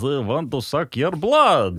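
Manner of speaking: put-on slow, drawn-out vampire in a Slavic accent. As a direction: ham up a storybook vampire. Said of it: "like, vampire territory".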